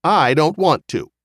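put-on high, loud small-child protest; objecting toddler. A narrator's reading. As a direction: refuse sharply like a three-year-old. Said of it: "Gregory objected sharply".

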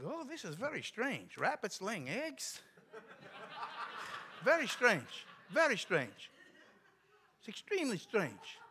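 put-on high, baffled Russian-accented deadpan; mock bewilderment in a foreigner's drawn-out accent; broken English. Visitor puzzled by rabbits laying eggs, broken English accent. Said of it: "he spoke real broken English".

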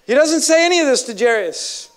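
usual speaking voice

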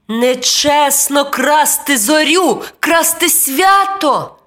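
high, slow, forceful scolding; angry boy. A narrator's reading. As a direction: scold indignantly as a little boy. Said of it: "сердито мовив хлопчик".

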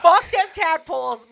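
slow, loud mom voice; mocking the mom. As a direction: play the fed-up mom. Voicing the mother.

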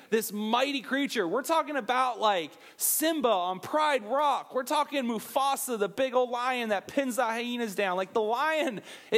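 usual speaking voice

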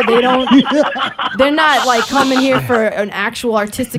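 loud retold tirade about common people; angry, passionate rant. Daughter warning mom off common people, angry rant replayed. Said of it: "'cause I'm angry, and, and that passion comes out".

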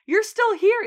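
high, loud startled remark; retold surprise. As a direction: exclaim it as if caught off guard. Retold Debbie finding the abandoned kid.

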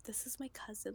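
quick whispered aside. Retold or imitated. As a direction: lean in and whisper it. Gushing aside about cousin photo.

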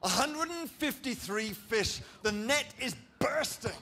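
high, drawn-out wonder; excited storytelling. A narrator's reading. Preacher telling the miraculous catch to the church, excited storytelling. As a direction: marvel at the huge catch, pitched high and stretched.

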